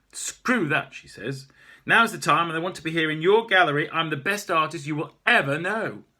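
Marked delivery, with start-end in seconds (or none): 0.1-0.9 loud, higher dismissive retort; furious refusal; defiant. Acted. Maeve defying the owner. 1.8-6.0 loud, higher boastful demand; demanding artist shouting; loud insistence. Acted. Maeve insisting loudly.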